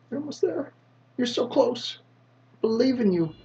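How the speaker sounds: a tiny squeaky cheerleader egging him on. Pretend. Cheering on his character.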